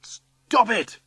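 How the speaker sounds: high-pitched yell of protest. A narrator's reading. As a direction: yell to make them stop. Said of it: "I yell".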